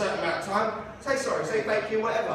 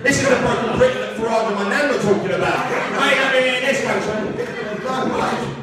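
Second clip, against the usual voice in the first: loud, hammed-up indignation; mock outraged rant; 5 dB louder than usual. Comedian laments broken Britain over Marmite, mock outraged rant. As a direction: rant loudly as if the nation were ruined.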